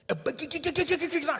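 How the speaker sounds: high, rapid-fire run of BIG; emphatic stutter-repeat. Real, not acted. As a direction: rattle off BIG in a high, staccato string.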